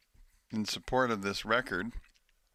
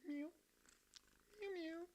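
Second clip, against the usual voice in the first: meowing; 21 dB quieter than usual. Real, not acted. Meowing at the cat.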